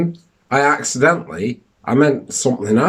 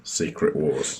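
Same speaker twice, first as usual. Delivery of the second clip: low movie-trailer announcer voice; deep trailer voice; 7 semitones lower, 9 dB quieter, slower than usual. Pretend. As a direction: announce it like a Marvel title. Marvel title voice.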